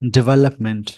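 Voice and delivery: डेवलपमेंट as "di-VE-lup-ment"; short "di", stressed VE in डेवलपमेंट; American pronunciation. Shown as a demonstration. Teacher echoing the word for learners, American pronunciation. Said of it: "इस word का जो American".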